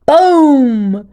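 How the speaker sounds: very loud boom; huge explosion sound. A narrator's reading. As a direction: blast it out like an explosion. Portal explodes sound effect.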